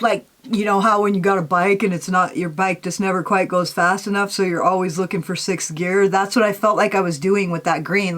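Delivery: normal talking voice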